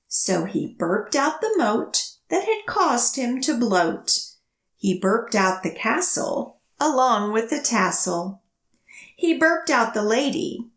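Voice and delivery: lively picture-book storytelling; reading to children. A narrator's reading. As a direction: read it to a room of little kids, bouncing the rhyme. Reads picture book aloud.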